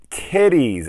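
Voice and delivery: high, loud mock-enthusiastic outburst; exclamation. Reviewer listing a film highlight, loud high exclamation. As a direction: shout it with an exclamation point. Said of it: "Exclamation point".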